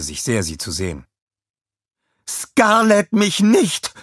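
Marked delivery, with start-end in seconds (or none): 2.3-3.9 high, loud, indignant yell; angry rebuke. A narrator's reading. Scarlett cutting off Kevin's greeting, angry rebuke. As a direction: throw the name back at him furiously. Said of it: "fuhr sie ihn an".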